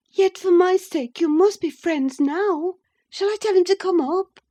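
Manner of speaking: wife coaxing her cross husband; Catherine, eager young wife. Acted. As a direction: coax him, bright and high.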